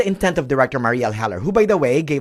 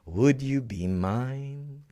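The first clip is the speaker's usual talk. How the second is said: Mister Rogers tune; 7 dB quieter, much slower than usual. Retold or imitated. Quoting Mister Rogers' song.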